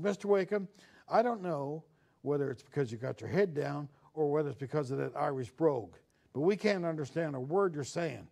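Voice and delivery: lightly voiced Southern nun with a Virginian drawl calling a new boy up. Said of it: "she had this Virginian drawl".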